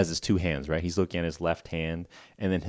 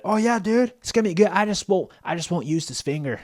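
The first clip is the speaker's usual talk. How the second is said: a chill California bro who only wants big air; mock California surfer dude, high and relaxed; California dude accent; 10 semitones higher than usual. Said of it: "super classic California accent".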